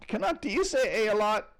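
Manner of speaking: high, loud teasing question. Real, not acted. Teasing Canadian viewer about saying eh, high and loud. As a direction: needle the Canadian playfully up high.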